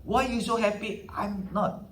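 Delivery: pouting, a hint of bitter jealousy; mock angry elder son. Preacher voicing the angry elder brother, mild mock sulk. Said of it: "dia marah".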